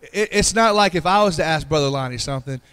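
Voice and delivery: ordinary delivery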